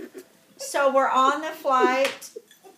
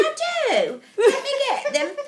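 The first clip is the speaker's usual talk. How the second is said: high, louder put-on English accent; mimicked English accent; 12 semitones higher, 6 dB louder than usual. Offering to show her pictures, high put-on English accent. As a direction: trill it eagerly in a fake English accent. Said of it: "we're talking like this the whole time".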